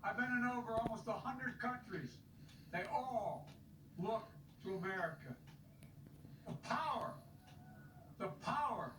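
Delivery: stirring speech building to a shout; rally the crowd, end on a rousing call. Acted. Rally speech continues, building to close.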